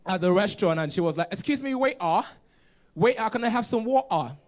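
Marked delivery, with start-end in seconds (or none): 1.3-4.4 high, loud mock British accent; hammed-up British accent, pitched up; British lady. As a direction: imitate a British lady calling a waiter, Ts dropped. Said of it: "I saw a British lady".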